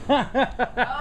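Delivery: high sheepish burst of laughter; embarrassed laugh. Real, not acted. Caught not recording the video, embarrassed laugh. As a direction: laugh at your own blunder.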